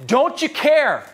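high, slow anguished plea to the sky; pleading cry. Retold or imitated. Seeker crying out to God in the storm, anguished plea. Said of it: "you said".

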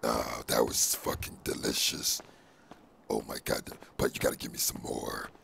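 whispered symbiote voice; breathy whispered monster; Venom whisper. Acted. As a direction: rasp it out as the creature in his head.

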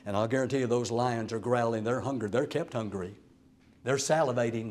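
ordinary talk, nothing marked

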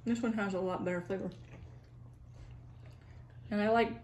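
chewing while talking; talking between bites; mouth full of tomato. Real, not acted. Seen 1: Bites tomato at -1 and +2.6, talks between bites holding it.

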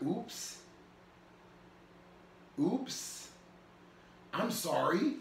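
small, soft, rising oops; mildly sheepish, halting; retold sheepish apology. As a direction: shrink back as if caught out. Retells sheepish reaction to God's rebuke.

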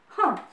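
loud scornful snort through the nose. A narrator's reading. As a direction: snort it out, dismissive. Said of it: "snorted the fat mouse".

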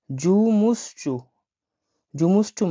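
জুমুসচু said very slowly, each syllable held; slow model word. Shown as a demonstration. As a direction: drag জুমুসচু out for the class. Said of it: "দেখুন লেখা আছে এখানে".

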